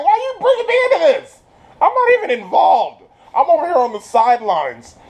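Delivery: very high, squealed comic protest through laughter; hammed-up "leave me out of it"; panicked mock protest. Guard dragged into the queen's quarrel, high laughing protest.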